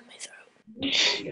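whispered, sore throat; hoarse whisper. Real, not acted. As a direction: whisper to spare your throat. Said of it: "I have a fucking sore throat".

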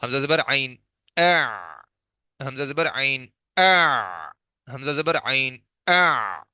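اع with a stopped throaty ain after a short a; spelling drill. Shown as a demonstration. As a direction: spell out حمزہ زبر عین, then a clipped اع. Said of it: "زبر لگا کر اس کو ساکن کر کے چیک کر کے".

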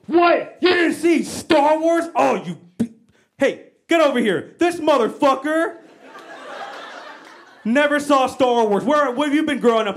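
outraged that someone never saw it; mad fan outrage. Retold or imitated. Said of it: "people get mad at me".

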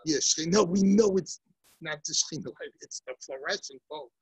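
ordinary talk, nothing marked

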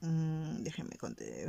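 ordinary talk, nothing marked

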